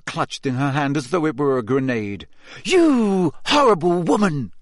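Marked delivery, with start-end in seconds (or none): 2.6-4.5 tight, pitched-up angry outburst; through gritted teeth. A narrator's reading. Hermione rounding on the journalist, gritted teeth. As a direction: bite it out through clenched teeth. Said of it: "through gritted teeth".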